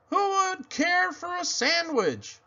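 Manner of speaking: high, sing-song call from afar. Acted. Faint voice far off calls out high. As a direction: lure him in from the distance. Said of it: "The voice called out".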